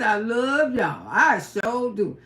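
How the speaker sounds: slow, low heartfelt thanks; mean every word; sincere. Real, not acted.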